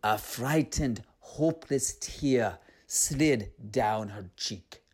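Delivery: usual speaking voice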